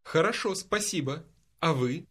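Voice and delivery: ordinary talk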